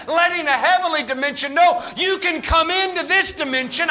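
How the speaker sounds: loud, driving sermon delivery; full-force preaching. Real, not acted. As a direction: preach it hard over the congregation.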